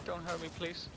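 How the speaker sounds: frightened. Acted. Shaken newcomer begs not to be hurt, frightened. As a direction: plead quietly, shaken up. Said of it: "his frightened state".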